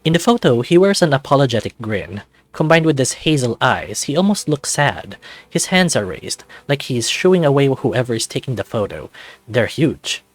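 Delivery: ordinary delivery, nothing marked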